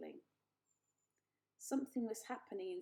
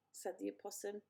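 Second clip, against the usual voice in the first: usual speaking voice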